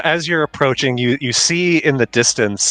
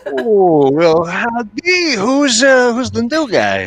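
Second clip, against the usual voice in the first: much higher, slow drawn howdy; squeaky little critter greeting; high creature voice; 12 semitones higher, 4 dB louder, slower than usual. Acted. Gobblefunk greets the parrot.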